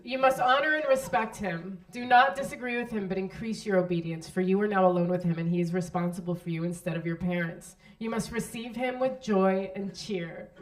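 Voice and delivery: solemn vow reading. Shown as a demonstration. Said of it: "I'm gonna read them to you".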